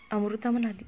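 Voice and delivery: ordinary delivery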